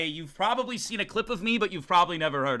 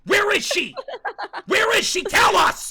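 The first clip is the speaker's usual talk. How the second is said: high, loud mock screaming; imitating frantic chat; 8 dB louder than usual. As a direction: demand answers at full voice. Acts out chat demanding guest.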